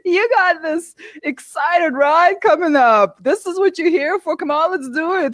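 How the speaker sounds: high-pitched motivating pep talk; encouraging inner voice. Retold or imitated. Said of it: "the second voice would say".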